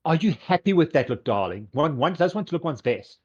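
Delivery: high, loud, grand fashion diva; Fabulous. Acted. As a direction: check on a client with a haughty lilt. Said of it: "look at Lilith and go".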